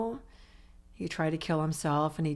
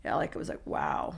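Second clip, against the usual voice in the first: hushed realization; 7 semitones lower, 5 dB quieter than usual. Real, not acted.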